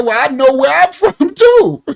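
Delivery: high, loud, laughing comeback; mock retort. American interviewer snaps back at the Congolese guest. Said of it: "the brother from America said".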